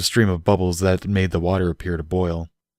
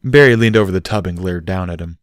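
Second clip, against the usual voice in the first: ordinary delivery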